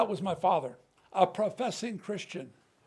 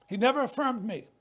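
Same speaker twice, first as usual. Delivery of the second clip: ordinary talk, nothing marked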